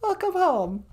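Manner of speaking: very high squeaky puppet wife greeting. Acted. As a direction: chirp it in a tiny high voice.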